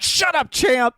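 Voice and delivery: high-pitched exasperated shout; imitated yell. Grumpy author silencing Champ, imitated yell. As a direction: snap it out as a mock yell. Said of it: "I'm sorry I yelled at you".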